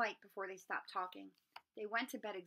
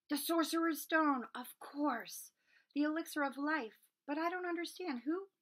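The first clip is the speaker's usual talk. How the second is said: higher and louder, quick realization; eager boy; 7 semitones higher, 4 dB louder than usual. Acted. Harry answers Firenze eagerly, then grows confused. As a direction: blurt out the answer, then falter.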